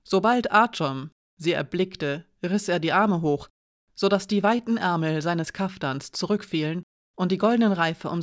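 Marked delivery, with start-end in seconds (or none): none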